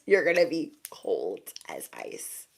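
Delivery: a slow, breathy whisper of the warning. Shown as a demonstration. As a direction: hush it like a secret curse. Repeated line, whispered then declared.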